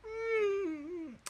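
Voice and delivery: very high, loud, slow mock delight; over-the-top falsetto gushing; amateur dramatics. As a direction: squeal with hammed-up rapture. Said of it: "it's a bit amateur dramatics".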